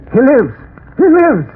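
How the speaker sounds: high, loud, rising exultation; triumphant cry. Acted. Professor seeing his patient breathe, exultant cry. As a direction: shout it in mad triumph.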